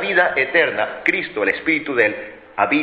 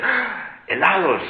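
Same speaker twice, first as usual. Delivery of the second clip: high amazed "Ah" before the question; mock-delighted child's gasp; wide-eyed child; 6 semitones higher, slower than usual. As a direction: imitate a kid hearing about ice cream. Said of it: "el niño abre los ojos y dice".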